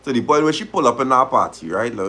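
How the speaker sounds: normal talking voice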